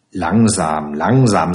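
low, measured restraint; calming. Acted. Man checks the angry matron, low and calming. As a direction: bring the pitch down to slow someone up. Narrator's figure calms the angry woman.

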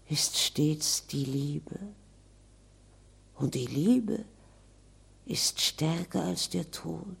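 a spirit dreaming of rest; longing ghost. Acted. Ghost longs for death. (sehnsüchtiges Gespenst, leise und langsam)

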